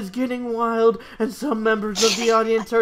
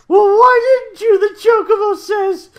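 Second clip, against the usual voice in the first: very high, loud squeaky bird voice; talking Chocobo; 13 semitones higher, 8 dB louder than usual. Acted. The Chocobo sassing Vincent, a very high squeaky bird voice. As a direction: squawk back cheekily.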